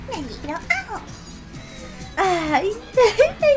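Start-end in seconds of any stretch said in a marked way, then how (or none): 2.5-3.5 high, loud happy squeal; excited squeal. Real, not acted. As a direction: squeal with delight.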